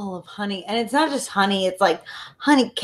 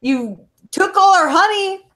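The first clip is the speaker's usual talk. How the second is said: indignant mayor, pitched up; angry mayor; 8 semitones higher than usual. Acted. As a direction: scold them as the robbed mayor.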